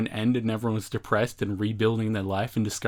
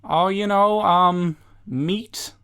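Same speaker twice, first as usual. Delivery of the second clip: Vinny; 8 semitones higher, 3 dB louder, slower than usual. Acted. Vinny voiced ordering pizza.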